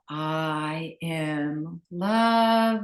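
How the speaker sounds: slow, drawn-out affirmation on the out-breath. Shown as a demonstration. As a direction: let the words ride out on the exhale. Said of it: "and exhale".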